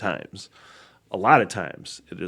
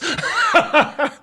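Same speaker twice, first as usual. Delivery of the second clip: high laugh. Real, not acted. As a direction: crack up at yourself. Laughs at own story.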